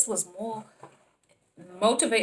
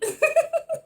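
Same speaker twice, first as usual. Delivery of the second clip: squealing laugh, much higher; high delighted laugh; 5 dB louder than usual. Real, not acted. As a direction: laugh with glee at the pun. Money pun delight.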